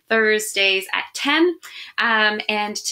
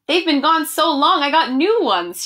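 higher and louder, delighted surprise; exclaiming; 5 semitones higher, 4 dB louder than usual. Acted. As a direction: burst out like a grandma finding lost glasses. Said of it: "she exclaimed".

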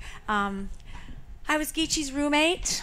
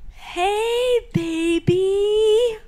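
high, slow crooning to the cat; imitating Geechee sweet-talking the cat; doing Geechee; 10 semitones higher, 4 dB louder, much slower than usual. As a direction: coo at the stray cat like Geechee did. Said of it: "I'm gonna do Geechee".

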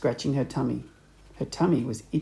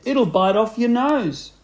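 high, loud, drawn-out taunt; menacing warning; 7 semitones higher, 5 dB louder than usual. A narrator's reading. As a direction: scare her with mock alarm, slowly. Husband frightening his screaming wife.

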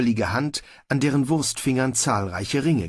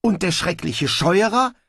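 high, puzzled question; baffled; 8 semitones higher, 3 dB louder than usual. A narrator's reading. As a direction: ask it taken aback, pitch lifted. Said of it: "fragte Snibrill verdutzt".

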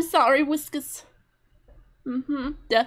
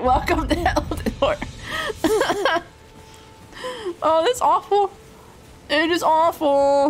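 ordinary delivery

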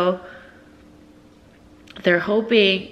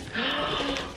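gasp; 6 semitones higher, 11 dB quieter than usual. Real, not acted. Unboxing a big doll.

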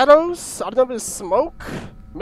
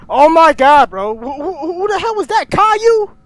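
high, stammering panicked outburst; startled alarm; 6 semitones higher, 9 dB louder than usual. Real, not acted. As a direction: voice climbing in shocked disbelief. Startled by figure chasing him.

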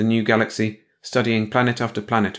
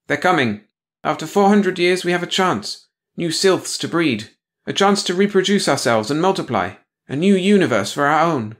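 higher and louder, eager triumph; Patara triumphant; 8 semitones higher, 4 dB louder than usual. Acted. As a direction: proclaim the long-awaited chance. Patara exults at the coming ship.

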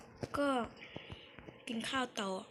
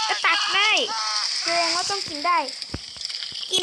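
much higher, much louder amazed cry; excited shout; 7 semitones higher, 9 dB louder than usual. Real, not acted. Player amazed that lava can be scooped, shouting excitedly. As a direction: yell it out in disbelief.

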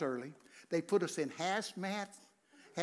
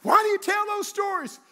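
higher, louder mock nagging wife; 10 dB louder than usual. Preacher quoting his wife's complaint, mock nag. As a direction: toss off the wife's complaint, hammed-up. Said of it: "My wife says".

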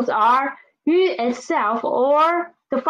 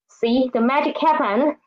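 ordinary talk, nothing marked

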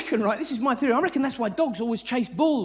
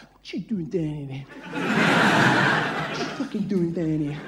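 much lower, slow gruff shark; 8 semitones lower, much slower than usual. Retold or imitated. Shark eyeing the diver in the cage, slow deep grumble. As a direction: grumble slowly like a puzzled shark. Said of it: "the shark going like that".

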